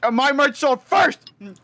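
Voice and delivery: cocky brag; bragging. Real, not acted. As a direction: gloat about selling first. Bragging about merch sales.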